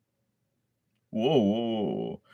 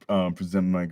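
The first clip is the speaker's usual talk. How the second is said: ordinary delivery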